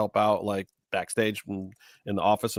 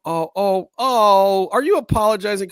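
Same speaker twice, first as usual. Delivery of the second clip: higher and louder, rising oh after oh; excited outburst; 9 semitones higher, 5 dB louder than usual. Real, not acted. Host excitedly calling out a co-host who is apologizing. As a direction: spot something funny and pounce on it.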